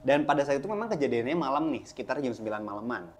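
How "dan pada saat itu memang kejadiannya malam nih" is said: plain reading voice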